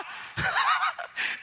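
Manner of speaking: bursting, high-pitched laugh. Real, not acted. Preacher laughing at his own joke, loud high laugh. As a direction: let a big laugh out.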